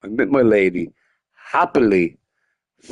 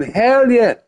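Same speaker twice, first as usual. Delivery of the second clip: excited cheer; 13 semitones higher, 6 dB louder than usual. Real, not acted. Excited cheer at bear time.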